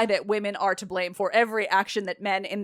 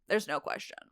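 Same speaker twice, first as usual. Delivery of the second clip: soft, falling afterthought; quieter repeat; 8 dB quieter than usual. Acted. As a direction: drop the volume, as a muttered aside.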